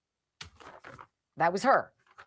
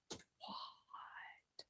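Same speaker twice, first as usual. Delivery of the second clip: stunned whisper; 27 dB quieter than usual. Real, not acted. Hearing of the huge order.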